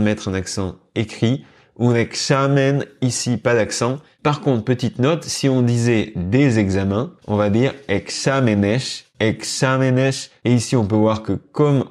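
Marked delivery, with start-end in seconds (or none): 1.8-2.8 "examen" with a higher, stressed XA; accent sur l'avant-dernière. Shown as a demonstration. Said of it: "on accentue justement l'avant-dernière syllabe". 7.9-10.1 "exámenes" stressed on XA, e-XA-me-nes; accent sur le A. Shown as a demonstration. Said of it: "mettre un accent écrit sur le A".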